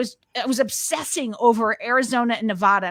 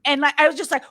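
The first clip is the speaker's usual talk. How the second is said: usual speaking voice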